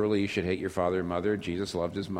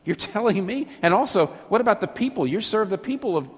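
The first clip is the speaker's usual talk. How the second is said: higher, louder, incredulous accusation; indignant disbelief; 9 semitones higher, 4 dB louder than usual. Retold or imitated. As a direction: throw the charge at David in outrage. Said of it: "a lot of people say".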